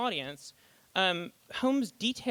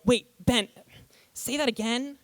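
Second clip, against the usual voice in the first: baffled interruption; 6 semitones higher than usual. Retold or imitated. Writer lost in the actor's math, baffled plea. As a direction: stop him mid-ramble, lost. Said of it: "suddenly go".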